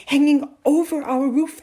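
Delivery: put-on high, loud, excited boy. A narrator's reading. Boy tells mother about the sky, excited. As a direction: burst out with amazing news. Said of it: "talking excitedly".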